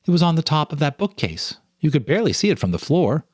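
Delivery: a hint of an excited young woman; Lisa's voice. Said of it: "Lisa pointed".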